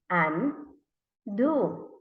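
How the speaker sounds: "undo" as un... do, with a gap; read by syllables. Shown as a demonstration. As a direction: pause inside "undo". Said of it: "read by syllables".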